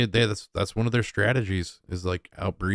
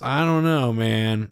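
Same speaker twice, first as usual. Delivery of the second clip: usual speaking voice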